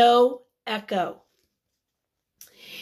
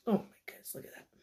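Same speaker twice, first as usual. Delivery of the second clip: whispered aside; 4 semitones lower, 14 dB quieter, much faster than usual. Real, not acted. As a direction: whisper it like a secret. Reader's hushed aside to children.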